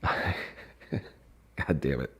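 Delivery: muttered curse through laughter; cracking up, barely getting it out; laughing curse. Real, not acted. Laughing at sovereign citizen text.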